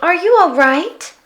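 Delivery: put-on high, bright-eyed child asking; a curious small girl checks on a sad creature; little girl voice. A narrator's reading. Little girl asks the Yeti what's wrong, high and curious.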